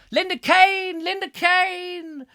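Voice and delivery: very high falsetto call, last one drawn out; silly name-call. Pretend. Presenter hailing a favourite viewer, silly falsetto. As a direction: trill the name in a comic high voice.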